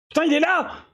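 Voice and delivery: sudden high burst of surprise; excited exclamation. Real, not acted. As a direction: blurt it out, thrilled. Spots the target after long search.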